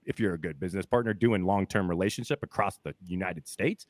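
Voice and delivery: ordinary talk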